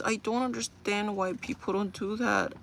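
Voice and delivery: ordinary delivery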